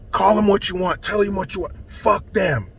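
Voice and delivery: usual speaking voice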